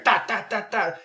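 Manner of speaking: high staccato gunfire imitation; imitated gunshots. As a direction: punch out shots as sharp syllables. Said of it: "The whole time you're hearing".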